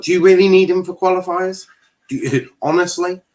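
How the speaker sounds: can't believe anyone would need him; incredulous. Real, not acted. Streamer asks the manager, high, loud and incredulous.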